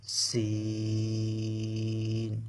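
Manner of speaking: সিন held long with a steady hiss; drawn-out sin. Shown as a demonstration. Teacher showing students the letter সিন, drawn out. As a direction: stretch the সিন out slowly. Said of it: "টেনে উচ্চারণ করেছি".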